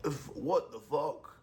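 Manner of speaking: slow, high mock Russian grumble; a rough attempt at a Russian accent. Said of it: "trying to do a Russian accent".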